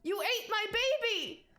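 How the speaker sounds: high-pitched, distraught mother; hysterical accusation. Acted. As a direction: wail the accusation at the culprit. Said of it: "this woman just seems hysterical".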